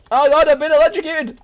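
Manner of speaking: high, loud hammed-up yelp; mock pained yell. Streamer tasered in game, loud mock pained yell. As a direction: cry out as if zapped.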